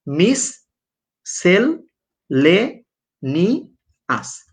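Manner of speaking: "মিস", "সেল", "লে নি আস" spaced out very slowly; syllable by syllable. Shown as a demonstration. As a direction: spell it out by sound, syllable after syllable. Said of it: "দেখো কত সুন্দরভাবে তোমাদের লিখে দিই".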